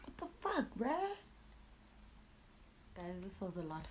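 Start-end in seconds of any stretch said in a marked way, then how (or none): 0.0-1.2 high, disbelieving mock outcry; stunned younger self. Her younger self reacting to her father, high and baffled. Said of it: "I'm like".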